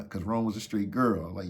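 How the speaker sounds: ordinary delivery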